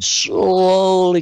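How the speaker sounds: slow, lingering crawl on the word slowly; drawn-out slowly. Retold or imitated. Guest describing the first Mars image creeping in, drawn out. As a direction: stretch SLOWLY out, long held first vowel.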